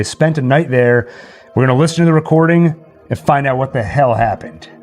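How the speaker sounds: normal talking voice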